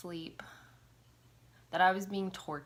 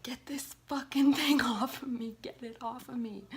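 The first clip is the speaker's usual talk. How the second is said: high, loud choking plea; pleading with mom, high and frantic; desperate plea; 7 semitones higher, 5 dB louder than usual. Retold or imitated. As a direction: beg them to get it off. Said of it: "I just kept pointing, like".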